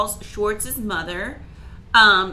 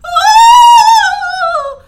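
long, very high shriek; piercing, drawn-out wail; mock horrified scream; 17 dB louder than usual. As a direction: imitate a mother screaming in horror. Mother's horror at swear.

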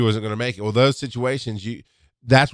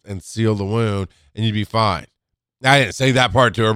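ordinary talk, nothing marked

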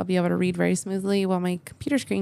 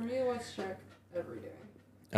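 hushed aside; 12 dB quieter than usual. Real, not acted.

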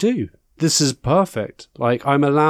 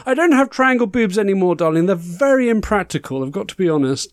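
campy drag queen; 5 semitones higher than usual. Acted. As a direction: gush every word like a glamorous diva. Host character, campy darling persona.

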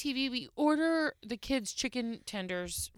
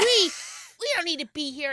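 higher, louder grumpy outburst; mock angry; 7 semitones higher, 7 dB louder than usual. Wife imitating husband wanting to leave, mock angry outburst. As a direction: imitate an indignant husband, raised voice. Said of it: "gets mad and grumpy".

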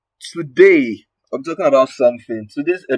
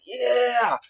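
high emphatic agreement; excited yeah; 10 semitones higher, 7 dB quieter than usual. Retold or imitated. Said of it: "And I was like".

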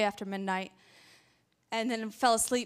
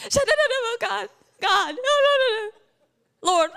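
high, loud mock wailing; hammed-up panic; 10 semitones higher, 7 dB louder, slower than usual. Preacher parodies fearful prayer, wailing in mock panic. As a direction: overact a panicked, crying prayer.

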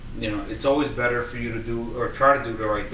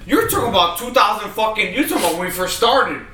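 higher, louder defensive protest; 8 semitones higher, 5 dB louder than usual. Real, not acted. Defending himself to a friend, loud and high. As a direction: push back hard, raising your voice.

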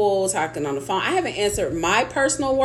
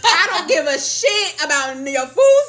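higher, fed-up mock rant with a laugh; exasperated; 7 semitones higher than usual. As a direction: vent at the caller, half laughing. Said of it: "I'm like".

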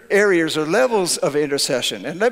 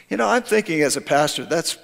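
normal talking voice

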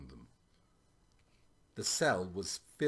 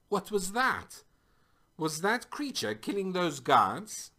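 high, louder horrified questions; 10 semitones higher, 5 dB louder than usual. A narrator's reading. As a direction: ask in mild, acted horror, pitch raised. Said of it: "Nat asked in horror".

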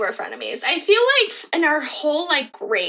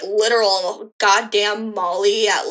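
usual speaking voice

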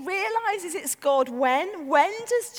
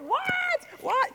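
high-pitched mock toddler confusion; bewildered child; 13 semitones higher than usual. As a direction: squeak it like a baffled child.

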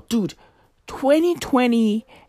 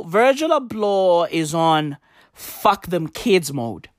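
normal talking voice

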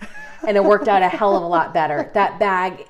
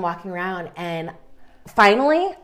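normal talking voice

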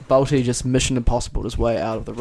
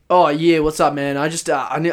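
louder, casual matey greeting; changed, blokey tone; 2 semitones higher, 5 dB louder than usual. Retold or imitated. Caller suddenly talking to a dude at the tyre shop, matey. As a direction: switch into imitated man-to-man chumminess. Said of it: "my tone changed".

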